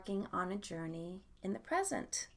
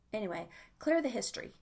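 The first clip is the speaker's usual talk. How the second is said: normal talking voice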